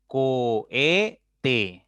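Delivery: "Cohete" drawn out as Co - he - te; teacher slowly sounding out "Cohete" beat by beat; syllable by syllable. Shown as a demonstration. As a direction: split "Cohete" into three long, separate syllables. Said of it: "Tiene tres sílabas".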